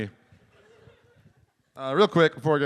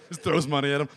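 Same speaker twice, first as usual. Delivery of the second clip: ordinary talk, nothing marked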